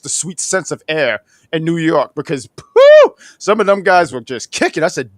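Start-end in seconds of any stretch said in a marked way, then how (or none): 2.6-3.1 high, slow disgusted exhale; high disgusted phew. Retold or imitated. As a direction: blow out a grossed-out phew. Smell of the crowd.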